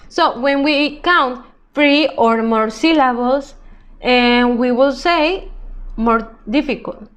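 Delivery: usual speaking voice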